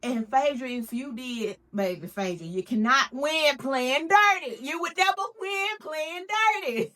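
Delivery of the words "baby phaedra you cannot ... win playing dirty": wag a finger, then laugh it off; sassy scolding. Real, not acted.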